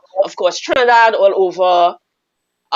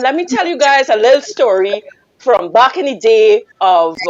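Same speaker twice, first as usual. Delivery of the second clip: normal talking voice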